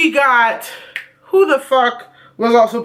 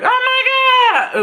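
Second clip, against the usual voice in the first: high, slow mock shriek; mock gasp of awe; 13 semitones higher, slower than usual. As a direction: ham up fake amazement. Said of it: "I wasn't like".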